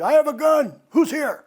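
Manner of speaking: high, loud shout at the intruder; grandma yelling at a prowler, loud and fierce; grandma's threat. Retold or imitated. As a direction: yell the threat into the dark. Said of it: "she's looking around".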